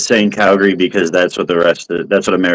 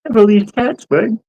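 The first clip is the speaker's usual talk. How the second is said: Bubbles impression; slower than usual. Friend tries the Bubbles voice, high and squeaky. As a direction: push the voice way up like Bubbles. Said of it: "I can't even do it".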